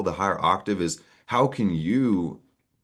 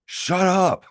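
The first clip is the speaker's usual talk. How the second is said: annoyed neighbor; 13 semitones higher, 3 dB louder than usual. Retold or imitated. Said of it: "Your neighbor's like".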